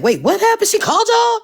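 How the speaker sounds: high surprised question; retold shock, pitch jumps high; shocked disbelief. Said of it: "I was like".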